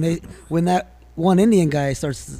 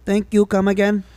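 put-on higher-pitched comic Indian accent; 6 semitones higher than usual. Co-host suggests a sign-off to the hosts, Indian accent. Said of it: "he's very good at doing the Indian".

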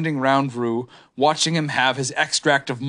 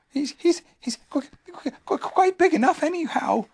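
high, timid stutter; a tiny nervous animal tripping on words; stammering Piglet; 12 semitones higher than usual. Acted. Piglet nervously answering Pooh, high and stammering. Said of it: "said Piglet".

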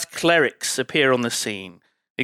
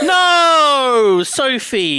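high, loud drawn-out objection; exasperated wail of disagreement; loud protest; 8 semitones higher, 6 dB louder, much slower than usual. Real, not acted. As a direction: cry out in disbelief at a friend. Protesting at friend's harsh opinion.

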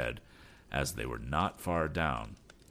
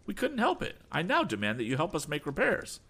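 much higher demanding voice; Berg voice; 10 semitones higher than usual. Acted. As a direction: demand help arrogantly.

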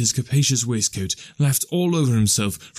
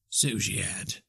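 subdued reply; 2 semitones lower, 10 dB quieter, slower than usual. Acted. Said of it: "cried Scrooge".

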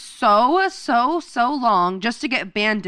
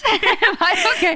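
giggling through the question, high and slow; laughing; 9 semitones higher, 3 dB louder, slower than usual. Real, not acted. Friend losing track of the story, laughing. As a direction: laugh while asking. Laughing at her own confusion.

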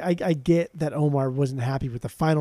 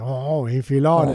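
louder, drawn-out mock French accent; 4 dB louder, slower than usual. Guessing how a French person says the word, mock French accent. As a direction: put on an exaggerated French "Oh". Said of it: "how would a French person say".

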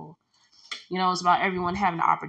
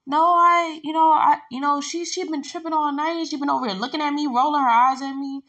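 higher, partly louder imitation of a defensive coworker; 8 semitones higher, 4 dB louder than usual. Coworker protesting to the lead, imitated high and defensive. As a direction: play the accused coworker, protesting. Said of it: "She's like".

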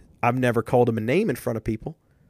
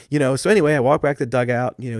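usual speaking voice

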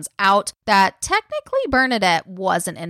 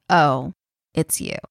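a lightly voiced, let-down "oh, it's you", a bit lower; disappointed; 4 semitones lower than usual. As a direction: sound let down that it's only her. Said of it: "She's disappointed".

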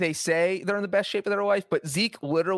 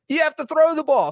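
exasperated emphasis; 11 semitones higher, 5 dB louder than usual. Real, not acted. Third repetition building to exasperated peak.

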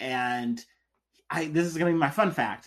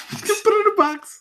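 laughing it out, pitch jumping much higher; 6 dB louder than usual. Real, not acted. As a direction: burst into a laugh on the words. Laughing at the joke.

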